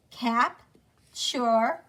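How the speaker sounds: "Cap, sure" as two separate syllables, CAP, CHUR; sounding out. Shown as a demonstration. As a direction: pause between the halves of capture. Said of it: "Sound it out with me".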